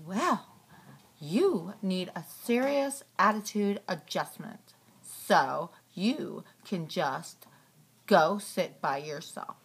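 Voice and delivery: low stern monster scolding; deep scolding voice. Pretend. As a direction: lay down the law in a big voice.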